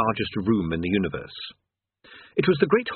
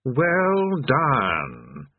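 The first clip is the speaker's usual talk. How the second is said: put-on drawn-out congratulation from an old demon; hearty cry. A narrator's reading. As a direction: draw out the praise, lightly voiced. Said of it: "he cried".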